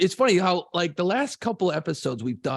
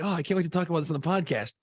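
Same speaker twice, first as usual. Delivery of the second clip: quick amused thought; 2 semitones higher, much faster than usual. Retold or imitated. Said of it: "I'm like".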